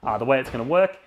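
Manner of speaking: loud, pumped-up stream announcer; hyped giveaway announcement. Acted. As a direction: sell the prize draw with energy. Louder run as he announces the patron draw.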